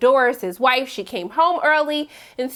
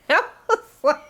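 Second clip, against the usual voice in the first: laughing; 3 semitones higher than usual. Real, not acted. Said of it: "I shouldn't be laughing at this".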